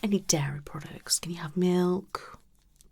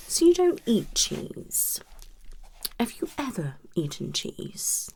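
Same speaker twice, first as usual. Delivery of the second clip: normal talking voice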